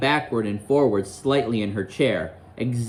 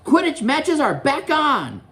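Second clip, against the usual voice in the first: high, slow, excited cry; excited roar; 13 semitones higher, slower than usual. Acted. Wood at breakfast cheering the news, excited high roar. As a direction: call it out high, stretching the words. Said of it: "roared Wood excitedly".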